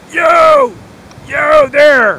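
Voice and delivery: very high, loud call from far across the room; retold shout across a hangar. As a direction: yell to get his attention across a hangar.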